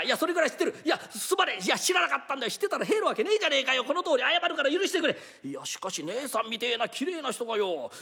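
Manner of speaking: flustered thief. Acted. Thief apologizes in a flustered rush to a fellow thief. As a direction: scramble to beg forgiveness.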